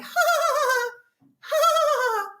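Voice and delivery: high, staccato imitated laugh; mock nervous laugh. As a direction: parody her awkward cover-up laughter. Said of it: "she starts laughing".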